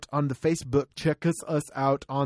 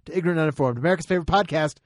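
announcer; 3 semitones higher, 5 dB louder, faster than usual. Acted. Podcast outro announcement.

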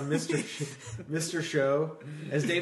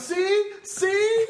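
mock shout; 9 dB louder than usual. David Cross character yelling.

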